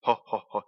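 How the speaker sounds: mock laugh. Mimicking laughter.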